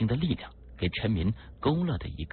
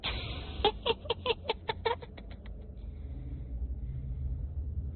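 long, very high shrill laugh; eerie high-pitched cackle trailing away; shrill corpse-demon laughter; wild cackle; 7 dB quieter than usual. A narrator's reading. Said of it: "竟然狂笑了起来".

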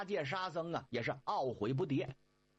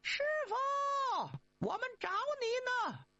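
very high long call; slower than usual. Acted. Monkey calling for master in forest, very high call. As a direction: call out far across the forest. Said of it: "这三位就喊开了".

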